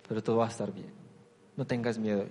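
hushed reassurance; soft comfort. Retold or imitated. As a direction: settle them gently.

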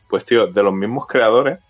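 ordinary talk, nothing marked

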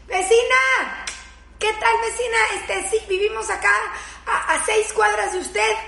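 high, overly sweet company voice; butter up the neighbor you barely know; high, sugary greeting to the neighbor peeking through her curtain; sugary neighborly greeting. Retold or imitated. Sweet-talking suspicious neighbour.

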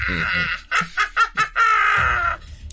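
very slow eerie ghost voice. Acted. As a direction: moan it out like a ghost. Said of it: "soy el fantasma".

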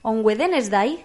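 a light Indian English lilt on "On Wednesday"; Indian English accent. Retold or imitated. Nun answering in a lightly imitated Indian English accent. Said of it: "el inglés indio es tan bonito".

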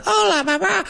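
squeaky, very high fake child; mock a dubbed kid as if on helium. Mocking kid dubbing in Spain, helium squeak. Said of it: "inhaled some helium and said".

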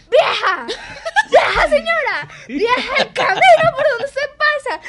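high, loud outraged yelling, laughing through it; teacher furious at being called vieja; imitated furious shout. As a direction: scream it like the insulted teacher. Teacher explodes at being called old.

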